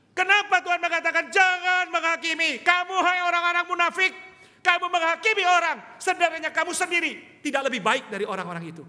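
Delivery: loud, high rebuke from the pulpit; full-force preaching. Acted. Pastor rebuking hypocrites, loud and high. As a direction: thunder the warning at hypocrites.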